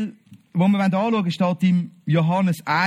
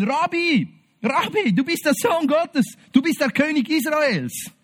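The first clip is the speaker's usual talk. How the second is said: higher, ringing, astonished; a skeptic overwhelmed, calling out in awe; awed exclamation; 7 semitones higher than usual. Acted. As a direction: cry it out as the truth dawns. Nathanael's awed confession.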